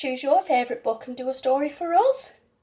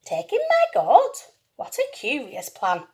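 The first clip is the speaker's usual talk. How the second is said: surprised. Acted. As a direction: squeak it out in disbelief. Lady baffled by odd advice.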